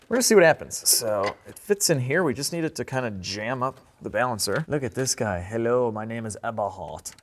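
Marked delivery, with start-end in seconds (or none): 5.4-7.1 lightly put-on German accent; a hint of a German accent. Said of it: "I'm German".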